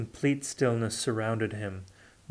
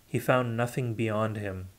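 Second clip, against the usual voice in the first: usual speaking voice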